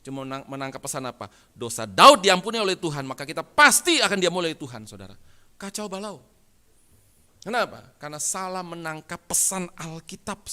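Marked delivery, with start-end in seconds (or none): 1.6-4.8 loud, raised preacher's voice; emphatic pulpit declaration; forceful preaching. Acted. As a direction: punch the key words loudly like a preacher.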